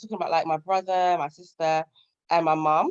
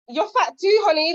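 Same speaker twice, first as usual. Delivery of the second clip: higher and louder, teasing sass; sassy comeback; 6 semitones higher, 5 dB louder than usual. Retold or imitated. To her teasing sister, a sassy higher, louder comeback. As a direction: fire it back at her with a grin.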